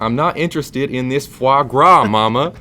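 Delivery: high, loud whiny brat; spoiled rich gamer kid. Pretend. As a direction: whine at Mama like a spoiled teen. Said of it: "Rich Southern gamer".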